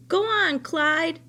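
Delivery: high, loud call to the scarecrow rabbit; loud urging. Acted. Old woman loudly urging the rabbit scarecrow. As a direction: egg him on loudly, high up.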